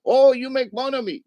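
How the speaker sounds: very high put-on voice with altered consonants; mimicking family member. Family member protesting the teasing, high-pitched mimicry. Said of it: "sound like this".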